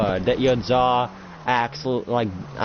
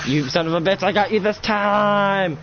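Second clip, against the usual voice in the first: triumphant taunt; 8 semitones higher than usual. Acted. Fighter landing the blow on his rival, gloating yell. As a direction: draw out the victory taunt. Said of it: "And he's just like".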